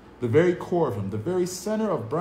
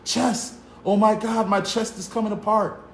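high, loud cry of chest pain; 8 semitones higher, 4 dB louder than usual. Acted. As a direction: cry out as the heart gives way. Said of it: "swore and hissed".

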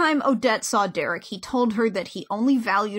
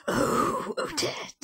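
whispered, sultry "Oh, Odette"; mock-seductive villain whisper; breathy villain whisper; 5 dB quieter, much slower than usual. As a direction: breathe her name like a creepy seducer. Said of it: "like, the voice he uses".

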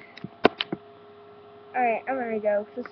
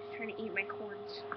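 ordinary delivery

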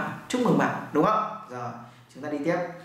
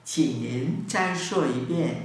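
slow demo; slower than usual. Shown as a demonstration.

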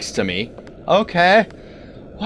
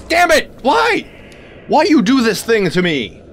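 frustrated shout; 7 semitones higher than usual. Real, not acted. Player shouting as the monster gets them again. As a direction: yell it at the screen.

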